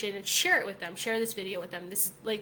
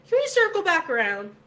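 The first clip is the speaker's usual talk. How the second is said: pointed challenge; 6 semitones higher, 7 dB louder than usual. Retold or imitated. Confronting coworker about his logic.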